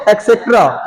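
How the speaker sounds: एक्सेट्रा with a k-s opening; wrong pronunciation. Shown as a demonstration. Teacher showing students' common mistake, एक्सेट्रा as ek-setra. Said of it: "बिल्कुल wrong pronunciation है".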